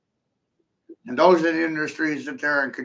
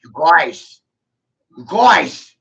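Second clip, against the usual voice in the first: calling out; 4 semitones lower, 8 dB louder, much slower than usual. Retold or imitated. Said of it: "one day I was like".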